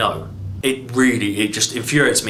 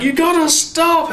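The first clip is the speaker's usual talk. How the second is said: much higher, louder desperate shout; mock Superman shout; 4 dB louder than usual. Reviewer voicing Superman begging Zod to stop, high shout.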